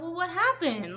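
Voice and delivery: high, loud baffled question; loud, high confused protest at the cliffhanger; baffled outcry. Retold or imitated. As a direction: demand answers from the book. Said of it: "I'm like".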